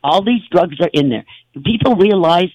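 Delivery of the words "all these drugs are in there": ordinary delivery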